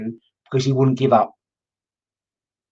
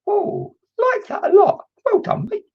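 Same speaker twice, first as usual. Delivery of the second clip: delighted squeal; slower than usual. Real, not acted. Thrilled the spirit gave details.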